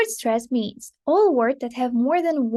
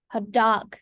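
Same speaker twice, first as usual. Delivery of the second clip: Hot dog as two words, weight on dog; stress on DOG; 2 semitones lower than usual. Shown as a demonstration. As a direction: lengthen dog, keep Hot unaccented. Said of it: "we pronounce it quite differently".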